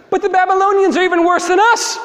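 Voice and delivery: very high, loud squawk of protest; high-pitched indignant yell; outraged outburst. Acted. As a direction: explode in comic disbelief. Prophet protests to God in outrage.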